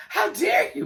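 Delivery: high, loud mock outrage; Kitty. Imitating Kitty offended by the laughing, shrieked indignantly. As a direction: shriek it as if deeply affronted. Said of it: "Kitty was like".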